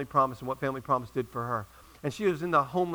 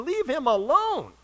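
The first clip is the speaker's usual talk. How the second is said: high, drawn-out mock wail of exasperation; hammed-up indignant outburst, very slow; exasperated protest; 10 semitones higher, 3 dB louder, much slower than usual. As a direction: stretch each phrase high, pleading to back off. Acted exasperated inner protest.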